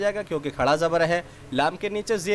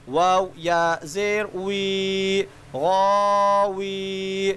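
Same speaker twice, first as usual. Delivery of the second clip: slow, drawn-out chanted letter recitation; sing-song spelling drill; 3 semitones higher, much slower than usual. Shown as a demonstration. Teacher spelling letters aloud for children, slow sing-song chant. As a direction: chant each letter and its vowel for young learners.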